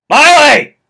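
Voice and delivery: high, loud called-out name; hammy stage actor. Acted. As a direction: holler it across the room. Calls name, then shouts it.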